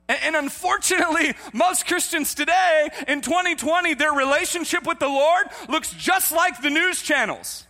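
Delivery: high, loud shouted sermon; full-force preaching. Real, not acted. Preacher likening believers' faith to news channels, full-force shout. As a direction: shout it across the whole congregation.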